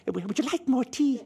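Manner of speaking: hammed-up middle-aged hostess; motherly hostess. Middle-aged woman offers tea, hammed-up and high. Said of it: "middle-aged woman to turn to you and say".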